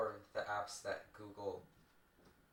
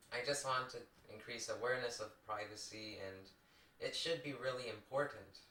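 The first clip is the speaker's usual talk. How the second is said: usual speaking voice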